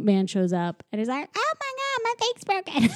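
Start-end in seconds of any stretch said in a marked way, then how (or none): 1.4-2.9 high squeaky voice, broken fake panic. Raincoat man panicking about his broken fake, squeaky high.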